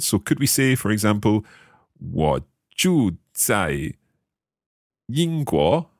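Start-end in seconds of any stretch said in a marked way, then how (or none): none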